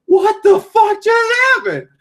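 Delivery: very high, loud shocked yell; shocked shout. Retold or imitated. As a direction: yell it in total disbelief. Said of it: "I'm thinking".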